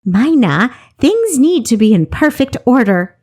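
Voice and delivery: high, insistent comeback; retort. A narrator's reading. Traeger answering a quip about his checking, retorting. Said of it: "he retorted".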